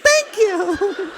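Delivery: high, laughing embarrassed thanks; sheepish laughing thanks. Retold or imitated. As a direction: thank him while laughing at yourself.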